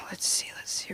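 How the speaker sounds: whispered to herself, hurried. Real, not acted. Comic whispering to herself while searching her notes. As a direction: breathe it out as an aside. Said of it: "whispered aside".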